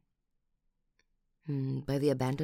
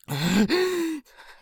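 loud high shocked gasp; big audible gasp; outraged gasp; 12 semitones higher, 10 dB louder than usual. Acted. Learns phone was stolen.

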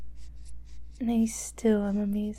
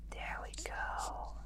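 whisper; 13 dB quieter, slower than usual. Real, not acted. Hushed feeding, partner sleeping.

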